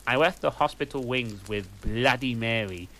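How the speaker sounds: usual speaking voice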